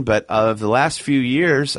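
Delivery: ordinary delivery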